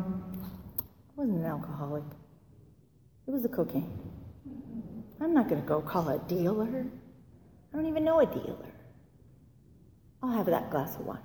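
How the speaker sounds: hushed, musing inner voice; quiet self-justifying. Retold or imitated. Future drinker reasoning toward a glass of wine, quietly. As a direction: think it through softly, as if to yourself.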